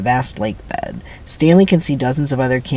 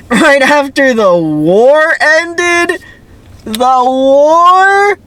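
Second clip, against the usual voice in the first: much higher, louder, slow disbelieving repeat; incredulous; 13 semitones higher, 7 dB louder, much slower than usual. Real, not acted. Reader mocking the screenplay, loud slow disbelief. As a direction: throw the line back in total disbelief.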